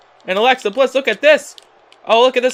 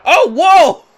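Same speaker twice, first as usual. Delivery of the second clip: excited whoa; 9 semitones higher, 7 dB louder than usual. Real, not acted. Big move in the match.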